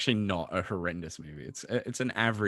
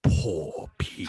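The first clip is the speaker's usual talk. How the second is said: slow, breathy hissing lizard villain; 5 semitones lower, much slower than usual. Acted. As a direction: hiss it out like a reptile. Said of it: "do your best lizard impression".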